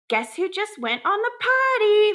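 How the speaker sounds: high, gleeful singsong announcement; oblivious excited mom. Retold or imitated. Mom announcing potty success to her daughter, imitated high excitement. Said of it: "Like, she's all excited about".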